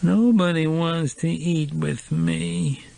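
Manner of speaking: put-on high, slow drunken whine; whining. A narrator's reading. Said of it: "she whined".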